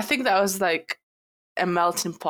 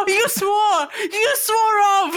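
high, loud "you swore" exclaim; shocked gasp; 11 semitones higher, 6 dB louder, slower than usual. Real, not acted. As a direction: gasp and cry out in mock shock. Shocked at swearing.

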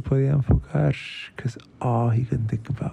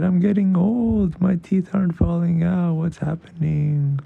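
put-on higher, louder child's fretting; worried boy; 6 semitones higher, 4 dB louder than usual. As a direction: whine like a kid who fears he's old.

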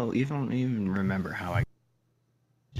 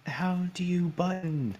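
high, slow, baffled voice; confused; 10 semitones higher than usual. Retold or imitated. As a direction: act lost with the controls. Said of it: "I'm like".

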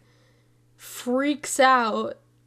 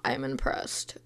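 much lower, soft deadpan voice; mock impressed; 12 semitones lower, 6 dB quieter, slower than usual. Hearing her casting news, a low mock-impressed voice. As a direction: drop the voice low, playfully. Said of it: "I was like".

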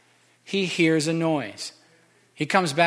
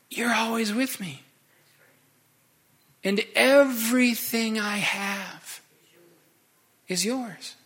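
tender; 6 semitones higher, slower than usual. Acted. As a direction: ease the words out slowly, full of love. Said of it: "with tenderness".

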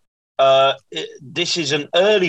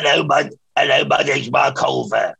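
lightly voiced caricature of himself; a touch of wheezy Bristolian self-parody; friend's impression of him. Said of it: "me doing John Richardson's impression of me".

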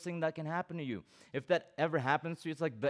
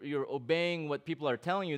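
ordinary talk, nothing marked

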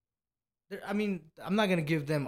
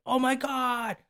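mock excited exclamation, pitched high; starstruck waitress; 10 semitones higher, 7 dB louder, slower than usual. Waitress spots a supposed celebrity in the casino, mock starstruck excitement. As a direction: imitate a waitress gushing at a celebrity sighting.

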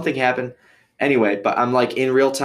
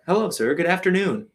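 cheery officer imitation, pitched up; high, chipper retold hello; chipper greeting; 8 semitones higher than usual. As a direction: greet like an over-friendly cop.

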